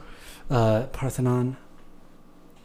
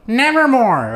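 raven imitation; 10 semitones higher, 5 dB louder, much slower than usual. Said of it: "They're like".

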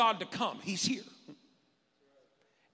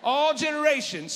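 higher, louder repeated call; call and response; 8 semitones higher, 8 dB louder than usual. Shown as a demonstration. Pastor leading congregation response, loud call. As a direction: lead the church to shout it back. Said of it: "Somebody say".

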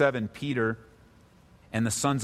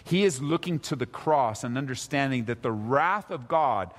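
usual speaking voice

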